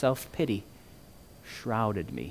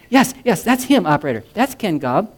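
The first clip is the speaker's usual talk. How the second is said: eager; 7 semitones higher, 9 dB louder than usual. A narrator's reading. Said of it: "I heard another voice say".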